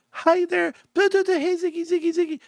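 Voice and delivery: put-on high, sing-song baby talk to the cat; wife's pet voice. Wife greeting the kitten Ziggy, high cooing baby talk. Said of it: "you got a voice for your kids, babies, and your animals".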